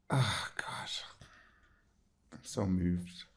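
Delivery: soft, breathy, near crying; holding back tears. Real, not acted. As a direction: fight the tears quietly. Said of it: "I'm trying not to cry".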